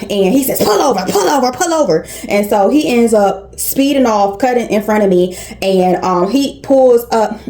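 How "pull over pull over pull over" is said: high, urgent imitation of an aggressive driver; mock-aggressive repeated command, pitched up; aggressive demand. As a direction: mimic someone insistently ordering a driver aside. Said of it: "like, just aggressive".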